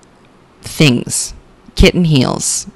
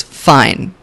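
usual speaking voice

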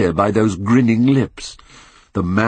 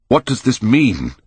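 raised, higher-pitched startled question; higher, urgent outcry; crying out; 5 semitones higher, 4 dB louder than usual. A narrator's reading. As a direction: cry it out, demanding an answer. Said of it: "cried Hallward at last".

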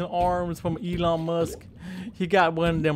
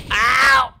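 sharp high cry of pain; pained yelp; 8 semitones higher, 9 dB louder than usual. Acted. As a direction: yelp like something just bit you. Gator bites him.

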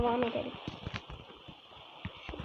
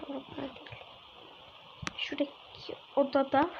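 ordinary talk, nothing marked

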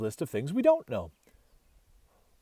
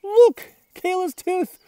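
much higher and louder, shouting the find; excited yell; 14 semitones higher, 6 dB louder than usual. A narrator's reading. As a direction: call out thrilled to have found it. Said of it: "I yell".

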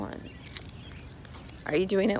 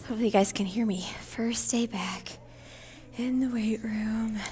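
whisper. Real, not acted. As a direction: hush it to the camera. Quiet gym, filming.

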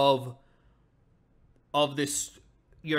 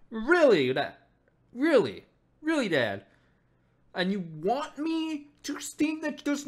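indignant daughter; 6 semitones higher than usual. Acted. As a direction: throw the accusation back at him. Voicing daughter confronting father.

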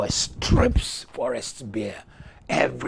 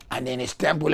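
usual speaking voice

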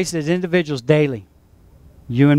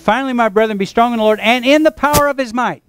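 raised, measured Bible reading voice; scripture reading; 5 semitones higher than usual. Real, not acted. Pastor reads Ephesians to the congregation, raised reading voice. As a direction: read the verse out to the church.

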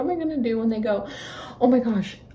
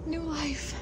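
hushed; 2 semitones higher, 12 dB quieter than usual. Real, not acted. Keeping quiet near the nest.

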